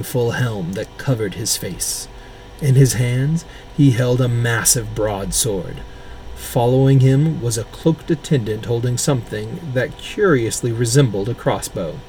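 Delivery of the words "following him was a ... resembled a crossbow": usual speaking voice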